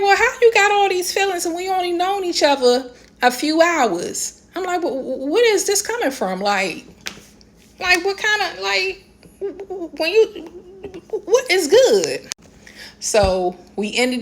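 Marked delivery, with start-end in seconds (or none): 0.0-4.4 high incredulous retort to a texter. Retold or imitated. Woman baffled by a man's sudden feelings, incredulous. 4.8-12.3 high, sputtering confusion; stammering disbelief. Retold or imitated. Woman sputtering at a man's odd text, disbelieving.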